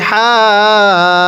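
হাজ্জা with a long হা, then joined to the জ; stretched then joined. Shown as a demonstration. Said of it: "আগে টান দিবেন".

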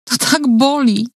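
high, slow, tearful wail; crying out through sobs to the nurse; sobbing. A narrator's reading. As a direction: let the pain break through in tears. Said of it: "zaniosłam się płaczem".

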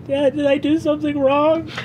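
put-on high, hurt little-kid voice; tearful child. Crying child asking Dad as he sends them away, tearful. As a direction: ask it as a child starting to cry. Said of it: "Kier starts crying and goes".

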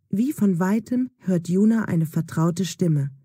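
ordinary delivery, nothing marked

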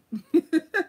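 high, loud laugh at herself; loud burst of laughter. Real, not acted. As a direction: crack up at her own bad accent. Laughing at her bad accent.

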